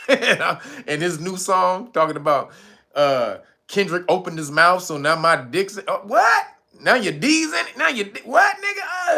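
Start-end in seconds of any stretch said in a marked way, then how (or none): 6.0-9.1 incredulous. Real, not acted. Mocking [name]'s new song to viewers, rising disbelief. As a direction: pitch climbing in disbelief.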